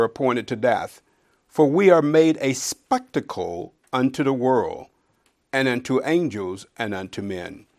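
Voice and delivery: loud, slow, fervent scripture reading; preaching scripture. Acted. Preacher reading Paul's letter aloud, loud and slow. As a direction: proclaim it to the congregation. Reads Paul's words with force.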